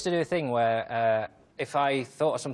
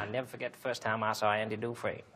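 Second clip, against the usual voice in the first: Morgan Freeman; 3 semitones lower, 7 dB quieter than usual. Pretend. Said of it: "sounds like Morgan Freeman".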